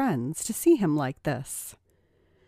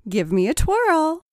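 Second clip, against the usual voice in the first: teasing command; 10 semitones higher, 5 dB louder than usual. A narrator's reading. Rachel commands playfully.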